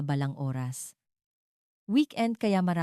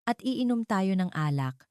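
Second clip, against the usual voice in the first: usual speaking voice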